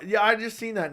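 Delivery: speaking through a hiccup. Real, not acted. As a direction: voice jumping with a hiccup. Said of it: "these hiccups are horrible".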